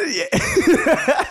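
giggling high through the words; laughing. Real, not acted. Laughing at own impression.

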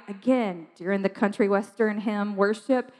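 ordinary delivery

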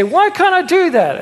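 high-pitched mock child protest; complaining kid. Imitating a kid challenging parents.

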